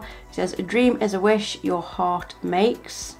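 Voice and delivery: ordinary talk, nothing marked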